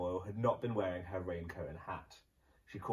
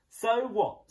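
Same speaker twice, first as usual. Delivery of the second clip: high, loud, careless family retort; loud defiant shrug of a reply; cheeky so what; 13 semitones higher, 10 dB louder than usual. Acted. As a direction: toss it off loudly, not caring. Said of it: "said the Gumboils".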